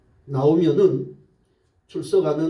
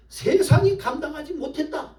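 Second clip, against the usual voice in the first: high-pitched preaching declaration; emphatic proclamation; 11 semitones higher than usual. Real, not acted. Emphatic sermon declaration, then repeated.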